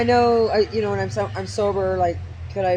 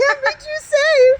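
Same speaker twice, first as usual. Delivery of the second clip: high mock prim voice; 6 dB louder, slower than usual. Joking voice.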